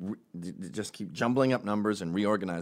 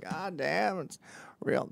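a hint of a Bill Clinton voice, higher; bad Bill Clinton impression; 7 semitones higher, 5 dB quieter, slower than usual. Bill Clinton reacting to the news, lightly voiced. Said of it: "I suck at impression".

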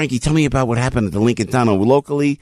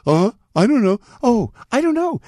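put-on much higher, confused old-lady voice; imitating Feinstein; 12 semitones higher than usual. Retelling Trump's rally, Feinstein baffled by the memo question, high and confused. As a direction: play a flustered, bewildered senator. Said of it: "And then he does Feinstein".

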